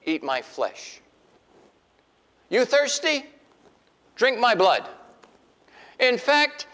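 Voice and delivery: building from calm to loud high proclamation; Jesus challenging the hungry crowd, rising loud; Jesus confronting. Retold or imitated. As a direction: start easy, then hit them hard. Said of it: "And he says".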